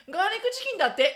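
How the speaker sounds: high, loud know-it-all claim; confident announcement. Retold or imitated. Telling her friend it's garlic chicken, loud and sure. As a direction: announce it like the expert.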